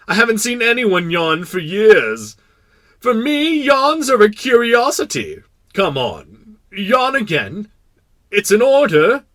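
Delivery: loud, high-pitched eager monarch; imperious king. Acted. King demanding another yawn from the prince, imperious.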